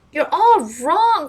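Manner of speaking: slow, high, loud outcry; cried out. Acted. Doctor Peck crying out to the accusers, slow and loud. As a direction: make them all stop and listen. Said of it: "cried Doctor Peck".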